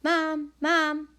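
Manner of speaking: high, loud call for Mom; calling out loudly, pitch way up; yelling. A narrator's reading. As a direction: yell for your mother in another room. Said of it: "then yells".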